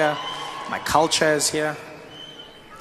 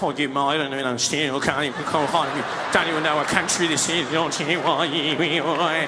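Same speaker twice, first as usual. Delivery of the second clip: bewildered Australian; 4 semitones lower than usual. Acted. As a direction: mumble helplessly like a lost Aussie. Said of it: "The Australian was like".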